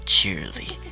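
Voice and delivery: ordinary delivery, nothing marked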